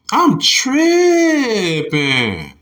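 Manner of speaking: drawn-out, high, loud cry at himself; excited outcry. Real, not acted. Laughing at his own mistake, stretched high outcry. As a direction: yelp it out slowly in disbelief.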